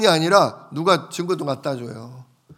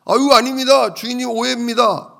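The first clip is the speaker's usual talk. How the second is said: much higher and louder, a flustered plea; a caught servant protesting too fast, pitched up high; panicked denial; 8 semitones higher, 5 dB louder than usual. Acted. Caught steward pleads with his master, panicked denial.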